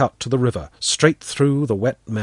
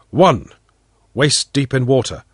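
ordinary delivery

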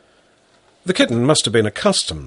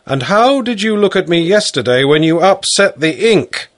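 teasing father; 7 semitones higher, 5 dB louder than usual. Acted.

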